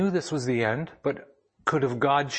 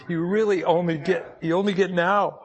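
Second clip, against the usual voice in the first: crying; 5 semitones higher, 4 dB louder than usual. Real, not acted. Pastor tells the congregation you only get now, crying openly. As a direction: voice breaking into tears, higher and pushing through. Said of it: "I'm sorry to be crying like a baby up here".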